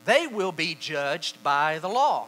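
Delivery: slow, loud scripture reading; forceful, drawn-out verse. Shown as a demonstration. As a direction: pronounce the verdict deliberately. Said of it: "I'm gonna read it slowly".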